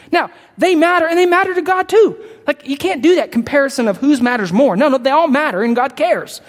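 impassioned pulpit build; fervent preaching. Real, not acted. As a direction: preach it with rising fire. Preaching peak.

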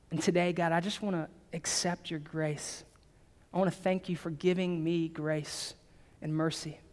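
low-key, softened prayer of acceptance; leading a prayer. Real, not acted. Pastor leading new believers in a prayer of grace, softly prayerful. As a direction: lead them gently in prayer, pausing between phrases. Leads congregation in repeat-after-me prayer.

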